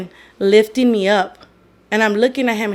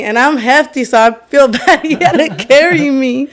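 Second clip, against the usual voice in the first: high, louder, laughing as she talks; 4 semitones higher, 6 dB louder than usual. Real, not acted. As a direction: crack up at your own story. Laughing about being carried.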